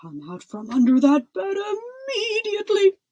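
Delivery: high, loud order; thundering command. A narrator's reading. King ordering his daughter out from the bed, thundering. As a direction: bellow the order, rising on the last word. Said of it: "thundered the king in such a loud voice".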